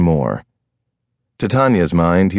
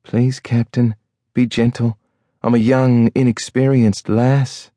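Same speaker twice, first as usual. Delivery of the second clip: higher, coy mock maiden voice; playing innocent lass; 5 semitones higher than usual. Wife teasing the captain in their game, higher and coy. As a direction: play the shy young lass in fun. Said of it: "keeping up the game".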